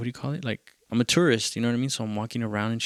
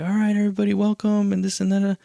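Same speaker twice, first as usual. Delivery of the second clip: high, projected emcee voice; imitated host announcement; 10 semitones higher, 4 dB louder, faster than usual. Host on the microphone welcoming a tiny crowd, imitated announcer call. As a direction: call out to the room like a host on the mic. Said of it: "he gets the microphone".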